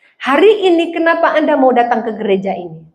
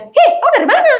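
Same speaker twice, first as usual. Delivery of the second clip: put-on sharp, much higher Eastern Indonesian accent, half laughing; loud high island accent; 13 semitones higher than usual. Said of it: "naik berapa oktav". (logat Timur yang tinggi dan keras)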